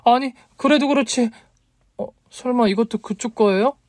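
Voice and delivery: very high, incredulous heroine; flustered protest. A narrator's reading. 놀라서 따져 묻는 여자. (당황해서 높은 목소리로 따지듯)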